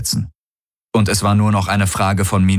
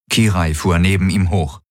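ordinary delivery

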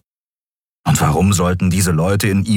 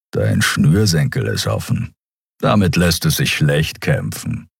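low beast rumble, lightly voiced; a big creature mumbling kindly; rumbling; 3 semitones lower than usual. A narrator's reading. The Skria points out Miko's loose shoelace, low rumble.

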